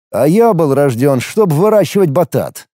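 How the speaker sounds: plain reading voice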